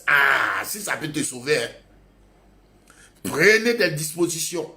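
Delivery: ordinary talk, nothing marked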